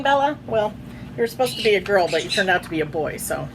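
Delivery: plain reading voice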